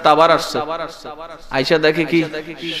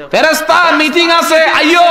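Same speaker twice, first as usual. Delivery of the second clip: calling out; 11 semitones higher, 6 dB louder than usual. Acted. God summons the angels to a meeting, calling out.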